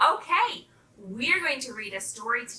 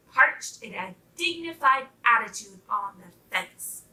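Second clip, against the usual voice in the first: ordinary delivery, nothing marked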